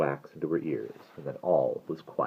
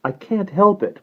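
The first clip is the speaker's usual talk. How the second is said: put-on high, plaintive girl's voice; high, helpless protest; soft cry; 8 dB louder than usual. A narrator's reading. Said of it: "cried Ariel softly".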